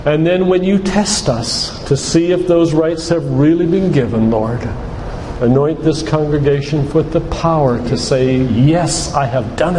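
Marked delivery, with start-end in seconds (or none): none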